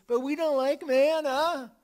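high, loud whining Israelites; whiny complaint. Retold or imitated. Complaining people to Moses, high loud whine. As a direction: whine it like a spoiled crowd.

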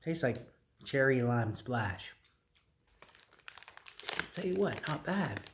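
chewy slightly muffled speech; talking while chewing. Real, not acted. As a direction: mouth partly full candy. Seen 0: Puts gummy in mouth at -9; chewing visible, lip-licking by 6.3.

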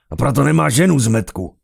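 loud, high angry roar; commander's roar. A narrator's reading. Commander roaring at his insolent subordinate, loud roar. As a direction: roar it at an underling. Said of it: "zařval velitel".

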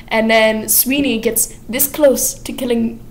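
ordinary talk

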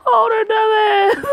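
high, loud indignant yell; outraged shout. Real, not acted. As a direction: shout at the character. Reader outraged at character.

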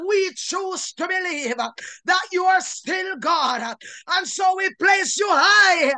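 ordinary delivery

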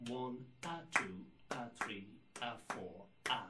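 swung one-a two-a; counting with a quick a. Shown as a demonstration. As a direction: tuck the a just before the next beat. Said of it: "Sometimes people like to say".

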